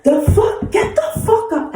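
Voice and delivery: very high shrieking rage; furious yell. Retold or imitated. Ordering the woman to get up, screaming mad.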